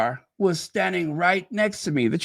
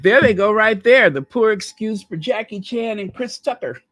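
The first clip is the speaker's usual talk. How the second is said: mock cop taunt; 6 semitones higher, 5 dB louder than usual. Mocking the police's view of them.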